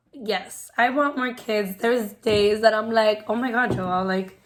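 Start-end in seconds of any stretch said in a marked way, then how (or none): none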